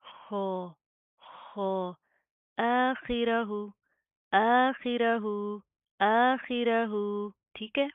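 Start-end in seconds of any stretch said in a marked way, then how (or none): 0.0-2.0 खो from the throat, harsh guttural; Arabic kho. Shown as a demonstration. Teacher showing the Arabic खो sound to students. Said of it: "Arabic में खो होता है". 2.6-7.3 slow, louder, guttural खि; tajweed recitation. Shown as a demonstration. Teacher reciting आखिरहू slowly for students. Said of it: "खो ज़ेर खी होता है".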